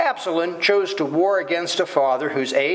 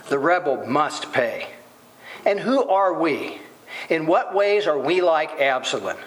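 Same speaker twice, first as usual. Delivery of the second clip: normal talking voice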